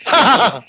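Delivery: staccato stagey laugh; big ha-ha-ha of derision; scoffing laugh. Acted. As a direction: laugh off the threat. Laughs off the threat.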